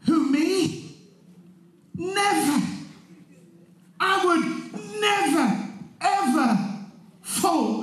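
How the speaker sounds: high, drawn-out indignant denial; emphatic denial. Acted. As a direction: stretch out each word as you swear it. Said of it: "Peter was emphatic when he said".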